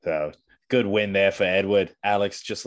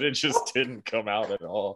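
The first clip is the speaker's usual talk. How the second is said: ordinary talk, nothing marked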